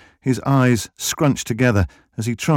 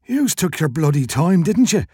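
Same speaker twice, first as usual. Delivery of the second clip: burly Irish shopkeeper, higher and indignant; grumbling wheezy shopkeeper; 6 semitones higher than usual. A narrator's reading. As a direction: complain in a wheezy, pitched-up Irish voice. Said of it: "he wheezed".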